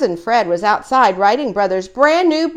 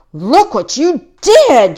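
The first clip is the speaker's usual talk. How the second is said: higher, much louder, slow furious shout; angry shout; 8 semitones higher, 9 dB louder, slower than usual. A narrator's reading. Brother shouting at Fred over the bent bike. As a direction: bellow the blame at him. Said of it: "shouted Brother".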